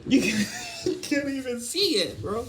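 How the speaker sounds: high voice breaking into laughter; laughing. Real, not acted. Telling bro it can't be seen, high and laughing. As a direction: struggle through the words, cracking up. Laughing while making the point.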